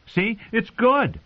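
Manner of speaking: put-on high, taunting young king voice; a spoilt boy king needling his uncle; cruel boy king. Joffrey taunts his uncle, high and mocking.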